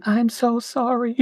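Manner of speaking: high, drawn-out little voice; tiny voice. Retold or imitated. Retelling a friend's apology after the shotgun blast, in a tiny voice. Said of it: "Newt's tiny voice going".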